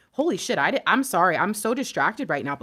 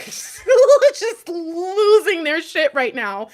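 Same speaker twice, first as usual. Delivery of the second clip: high, loud, drawn-out sobbing burst; real tearful outburst; breaking into tears; 10 semitones higher, 9 dB louder, much slower than usual. Real, not acted. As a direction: let the cry burst through the words. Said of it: "Try not to cry right now".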